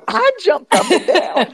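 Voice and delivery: laughing as she speaks, high and bright; real laughter through the words; high-pitched laughing delight. Real, not acted. Guest recalls excitement, laughing.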